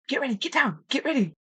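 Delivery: high, pressing command to take cover; urgent hunting partner. Retold or imitated. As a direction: hurry her down before the elk sees. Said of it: "and he goes".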